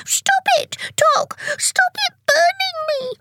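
very high, pained wailing plea; begging. A narrator's reading. Tick burnt by hot cocoa, begging Tock shrilly. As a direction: squeal for mercy. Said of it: "he begged".

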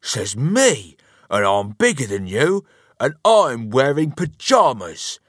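put-on loud, childishly bragging retort; loud, self-important husband voice; bossy boasting. A narrator's reading. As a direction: puff yourself up and argue back. Husband boasts back in the squabble.

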